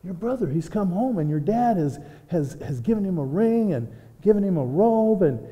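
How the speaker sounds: high, breathless, tumbling out the news; excited servant. Acted. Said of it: "He says".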